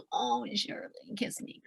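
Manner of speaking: slow, hushed, then a whisper; soft pet talk. Retold or imitated. Talking tenderly to a pet, soft whisper. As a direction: coo it to your pet. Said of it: "you're like".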